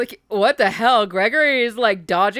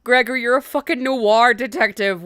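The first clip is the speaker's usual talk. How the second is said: ordinary delivery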